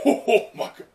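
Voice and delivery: gasp-laugh at what you just spotted; real delighted laugh over the words; laughing surprise. Real, not acted. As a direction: burst out laughing, high and loud. Sudden discovery while reading.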